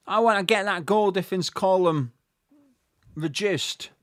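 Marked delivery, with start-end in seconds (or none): none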